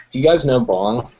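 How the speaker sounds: usual speaking voice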